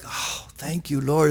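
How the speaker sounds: soft, low sigh of gratitude; relieved thanks. Retold or imitated. Thanking the Lord when it started raining, softly relieved. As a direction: re-enact hushed relief, voice dropped.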